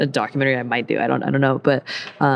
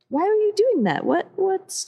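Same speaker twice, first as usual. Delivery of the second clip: very high-pitched questioning; mock harmless curiosity. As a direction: imitate a puzzled onlooker with pitch way up. Voices onlooker, not treating her as menacing.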